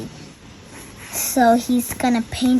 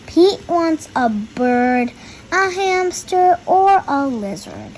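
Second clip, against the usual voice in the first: put-on reading to children; 2 semitones higher than usual. A narrator's reading. Reads the picture book aloud.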